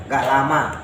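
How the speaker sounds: normal talking voice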